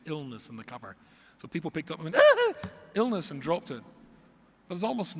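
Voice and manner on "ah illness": high, loud mock gasp at a dreaded word; hammed-up alarm; horrified recoil. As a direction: recoil in comic horror, voice leaping up. Browsers recoil from book cover.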